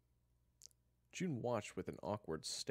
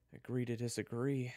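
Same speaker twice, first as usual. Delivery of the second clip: ordinary delivery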